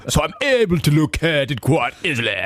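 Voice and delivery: high, hammed-up toff voice, fog and coffee rounded; mock posh British. Host riffing on the coach's sentences in mock posh accent. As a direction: camp up the Lord Snooty accent. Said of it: "started talking like that".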